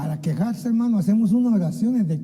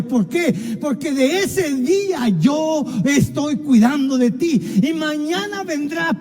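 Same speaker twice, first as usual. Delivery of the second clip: fervent preaching; 5 semitones higher than usual. Acted. Pastor rousing the congregation, full-force preaching. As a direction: build and pound each proyectando.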